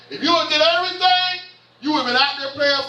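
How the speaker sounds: high, drawn-up scolding pitch. Real, not acted. Preacher scolding over unfinished chores, high-pitched. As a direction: lift it high like a parent lecturing.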